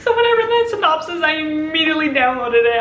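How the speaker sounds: high, loud excited rush; excited squeal. Real, not acted. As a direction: gush about it in a fast high squeal. Excited about a sad book.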